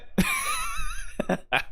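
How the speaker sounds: very high squealing laugh; high, breathy giggle; real laugh. Real, not acted. Streamer laughs at game line.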